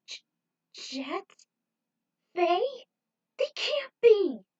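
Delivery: high, loud, slow horrified cry; Trudy seeing Jet and Faye brainwashed, high and loud; horrified disbelief. A narrator's reading. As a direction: shriek their names in horror. Sees brainwashed friends.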